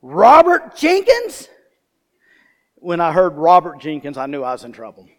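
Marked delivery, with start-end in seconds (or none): 0.0-1.5 high, loud full-name call; yelling mom. Retold or imitated. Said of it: "I hear yelling".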